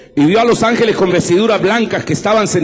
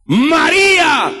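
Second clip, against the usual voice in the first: preacher voicing Jesus calling Mary, shouted; 10 semitones higher, much slower than usual. Stretch María into a full ringing shout.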